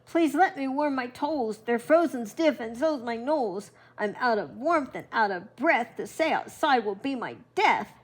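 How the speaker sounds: grandma voicing the fox begging to get warm; wailing fox. A narrator's reading. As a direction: wail it out like a frozen fox begging. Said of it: "Fox wailed".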